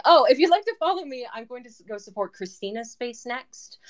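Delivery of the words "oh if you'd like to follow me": high, fast and loud restart; sudden burst of energy. Real, not acted. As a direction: jump back in brightly and quickly. Restarts the sentence with more energy.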